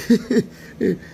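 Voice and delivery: high imitated chuckle of an older nurse; the nurse laughing at his complaints, high chuckle; nurse's laugh. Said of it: "she was laughing like".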